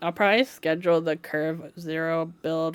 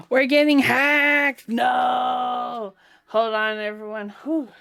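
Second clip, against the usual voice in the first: high, loud hammed-up alarm; shouting to chat that they're getting hacked; mock panic shout; 7 semitones higher, 6 dB louder, slower than usual. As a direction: play up the fake emergency.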